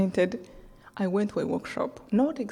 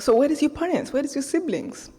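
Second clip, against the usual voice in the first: quoted questions pitched higher; quoted stranger's questions; 6 semitones higher than usual. Retold or imitated. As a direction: ask gently, voice lifted. Man asks about her family.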